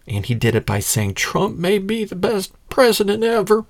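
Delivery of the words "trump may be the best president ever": high-pitched fawning imitation; mock genuflecting. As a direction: imitate a flatterer bowing before a king. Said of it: "The genuflecting".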